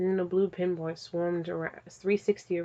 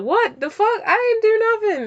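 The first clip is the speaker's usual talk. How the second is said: high, loud outraged denial; best friend squealing a guilty denial; indignant best friend; 10 dB louder than usual. Retold or imitated. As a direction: protest your innocence way too hard. Acted best friend protesting.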